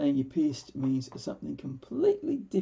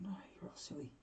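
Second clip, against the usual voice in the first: soft whispered aside; a quiet private mutter; said under breath; 14 dB quieter, faster than usual. Retold or imitated. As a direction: whisper it to yourself so nobody hears. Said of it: "I didn't say that aloud".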